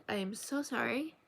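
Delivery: plain reading voice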